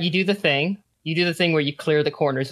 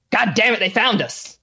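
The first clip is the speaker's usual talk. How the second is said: a touch of a higher angry yell; angry man; 7 semitones higher than usual. A narrator's reading. Hiding smuggler curses at being found, mild yell. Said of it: "a grown man's voice say".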